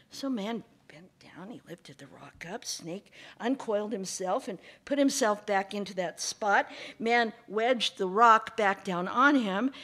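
usual speaking voice

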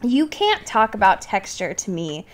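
ordinary talk

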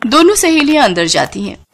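ordinary delivery, nothing marked

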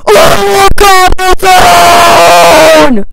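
high, drawn-out full-volume scream; deafening shout. Pretend. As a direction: scream it long enough to deafen listeners. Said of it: "Now you've gone deaf".

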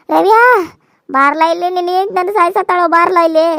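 loud angry summons across the house; calling out loud. Acted. Mother-in-law shouting for her son Ravi to come.

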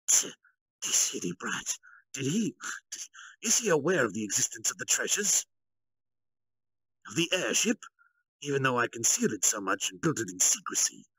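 lightly voiced grumbling general; a hint of a scheming officer's annoyance; Kalgurio's irritated thoughts. A narrator's reading. Kalgurio privately grumbles about the brat, lightly voiced. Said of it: "But Kalgurio thought about it".

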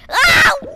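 loud high ow; sharp yelp of pain. Real, not acted. Hit in the game.

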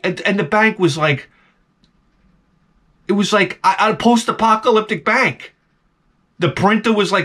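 ordinary delivery

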